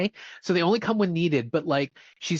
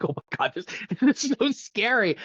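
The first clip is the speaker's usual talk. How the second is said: mock horror; 7 semitones higher than usual. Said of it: "I'm like".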